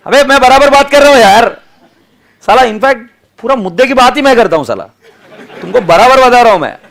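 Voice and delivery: loud, high protest; mock-indignant shout. Teacher protesting to his laughing class that he talks sense, mock-indignant shout. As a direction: raise the voice in comic outrage.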